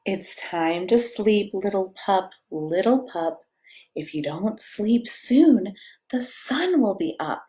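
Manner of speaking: slow, gentle bedtime read-aloud; cozy storybook reading; reading to children. A narrator's reading. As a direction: lull a toddler toward sleep. Reads picture book to child.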